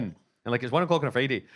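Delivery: usual speaking voice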